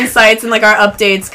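ordinary talk, nothing marked

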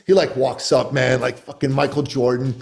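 ordinary talk

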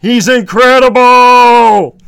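high, loud, drawn-out sitcom brother; Raymond. Acted. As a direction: stretch the words out in loud amazement. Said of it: "Raymond says".

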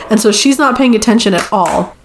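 normal talking voice

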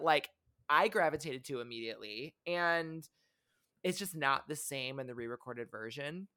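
ordinary talk, nothing marked

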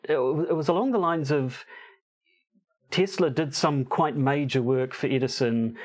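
ordinary talk

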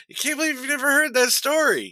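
high-pitched, genuine disbelief; incredulous. Real, not acted. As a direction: marvel at your friend's ignorance, pitched well up. Disbelief at friend's ignorance.

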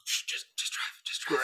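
whispered, urgent hush. Acted. Player hushing the driver, whispered. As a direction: shush the driver under your breath. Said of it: "hushing driver".

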